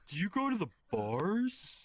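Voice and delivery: lightly voiced blunt coworker; coworker impression. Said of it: "he talks like this".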